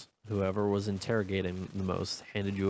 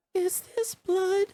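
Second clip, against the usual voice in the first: put-on little girl voice; 6 dB louder, slower than usual. NPC girl, frightened question.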